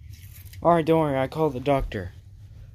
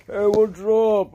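loud, high, slow drawn-out hello; booming, stretched-out greeting; exuberant greeting; 7 semitones higher, 8 dB louder, slower than usual. Acted. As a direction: greet a buddy with a big stretched holler. Friend greets Bobby exuberantly.

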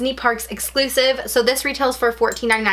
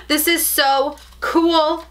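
delighted gasp; 5 semitones higher, much slower than usual. Real, not acted. Admiring the wallet.

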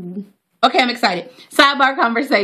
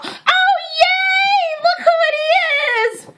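high squealing yay; delighted high cry of recognition; excited shout; slower than usual. Real, not acted. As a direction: shriek with joy at a familiar face. Sees her friend in the mag.

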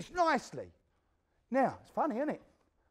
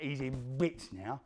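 ordinary talk, nothing marked

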